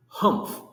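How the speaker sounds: high, loud scornful humph; sour humph. Acted. Sour kangaroo scoffing at Horton, loud scornful humph. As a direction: huff out a sniffy humph. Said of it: "humphed a voice".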